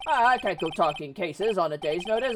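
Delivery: blustering, stammering lawyer role; evasive Grossberg. Acted. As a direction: fend them off with loud hesitations.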